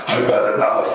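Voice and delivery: breathless, laughing whispered i've had enough; half-joking worn-out dad; fed-up aside. Retold or imitated. Dad admitting his inner thought at the play place, whispered and laughing. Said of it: "And I'm like".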